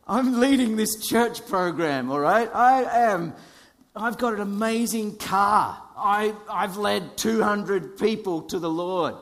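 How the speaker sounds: high, loud, self-important bragging; mock boasting. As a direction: puff yourself up like a show-off. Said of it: "Sorry, that was me".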